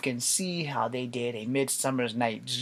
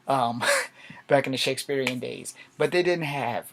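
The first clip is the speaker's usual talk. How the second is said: ordinary talk, nothing marked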